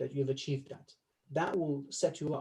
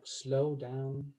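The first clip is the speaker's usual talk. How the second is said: drawn-out, very slow, sleepy delivery; exaggerated slow speech. Shown as a demonstration. Coach mocks overdone slowing in a hypnosis class, very slow. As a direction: stretch it out as if lulling someone to sleep. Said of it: "It's not a hypnosis class".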